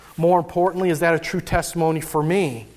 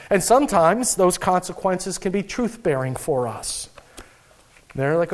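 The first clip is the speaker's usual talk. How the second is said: ordinary talk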